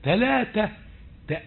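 ordinary talk

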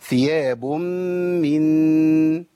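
ثياب من with the nasal held long on من; slow held nasal; four-beat nasal hold. Shown as a demonstration. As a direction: stretch the ghunna of ثياب من slowly. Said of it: "أجيب أربع حركات".

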